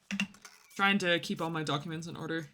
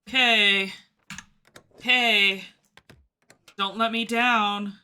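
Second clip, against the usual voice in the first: loud, slow plea; pleading loudly with the game; pleading call; 2 semitones higher, 7 dB louder, much slower than usual. Real, not acted. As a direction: call out, urging it not to fail. Calling the game to not fail.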